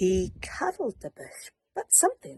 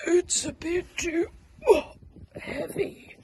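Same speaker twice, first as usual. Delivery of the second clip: straining; 10 semitones higher than usual. Acted. Bear strains under heavy hug.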